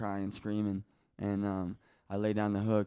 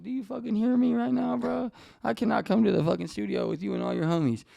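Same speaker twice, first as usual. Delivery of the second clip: high, loud outburst; mock distraught protest; 12 semitones higher, 10 dB louder than usual. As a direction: re-enact refusing a friend at full pitch. Upset, refuses the studio invitation.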